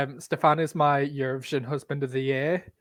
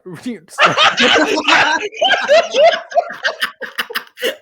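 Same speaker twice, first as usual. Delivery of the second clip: loud laugh; 9 dB louder than usual. Real, not acted. Laughing at teasing about accent.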